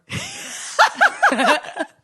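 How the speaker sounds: loud squealing laugh; high laugh. Real, not acted. Laughing at the robe story.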